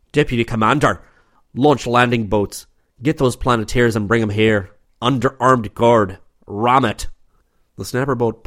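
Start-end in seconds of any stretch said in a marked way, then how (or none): 0.1-7.1 yelled orders. A narrator's reading. Angry commander yells orders to his deputy, loud barked commands. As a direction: yell the orders into the communicator. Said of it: "O'Brien bent over the communicator and yelled".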